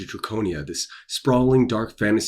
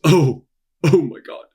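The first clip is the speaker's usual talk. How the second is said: delighted laugh; 7 semitones higher, 6 dB louder than usual. Real, not acted. Gushing about fan art.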